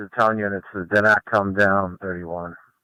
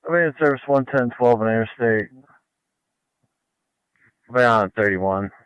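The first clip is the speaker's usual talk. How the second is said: tinny scanner voice; calling in over the radio; police radio; 11 semitones lower than usual. Real, not acted.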